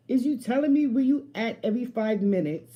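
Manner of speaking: high, loud, fed-up question; indignant challenge. Retold or imitated. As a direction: demand an answer, loud and high. Quoting her challenge to him.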